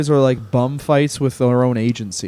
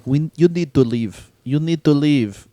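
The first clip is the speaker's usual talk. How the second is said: a hint of a calm, slow bouncer; slow and unbothered, lightly voiced; calm Russian bouncer. Bouncer calmly telling a drunk to leave, lightly voiced. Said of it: "But, like, calmly".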